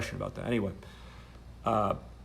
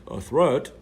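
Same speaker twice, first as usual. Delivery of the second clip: own voice, mocked; 5 semitones higher, 10 dB louder than usual. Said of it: "doing her voice and my voice".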